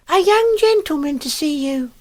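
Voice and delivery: high, formal announcing voice; maid's announcement. A narrator's reading. Parlour maid announcing the visitor, high and formal. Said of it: "announced the parlour maid".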